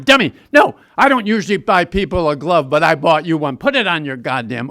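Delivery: loud, impatient coach voice; loud, irritated coach barking orders at a kid; gruff scolding. Retold or imitated. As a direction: order the dummy around, loud and annoyed. Gruff coach ordering him around.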